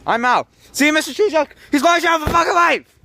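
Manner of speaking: loud, high panicked yelling; panicked shouting. Real, not acted. Son fleeing his angry dad, panicked shouting. Kid flees chasing dad.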